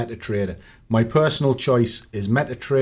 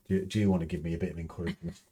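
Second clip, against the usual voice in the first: soft, quick off-mic question; quiet aside; 5 semitones lower, 9 dB quieter, much faster than usual. Real, not acted. Asking the partner in the room for encouragement, quiet aside. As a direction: drop the voice and ask someone nearby.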